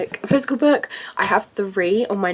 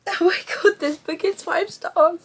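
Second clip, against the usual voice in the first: high-pitched teary exclamation; crying; 9 semitones higher than usual. Real, not acted. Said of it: "I don't know why I'm crying".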